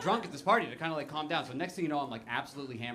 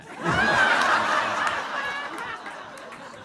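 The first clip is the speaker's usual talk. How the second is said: high whooping laugh; 8 dB louder than usual. Real, not acted. Big laugh at punchline.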